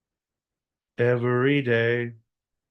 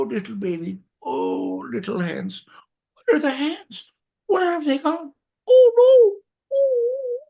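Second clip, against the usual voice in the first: much higher cooing, breaking into a wail; high baby coo; 4 dB louder than usual. Acted. Mourning the lost baby hands, high cooing wail.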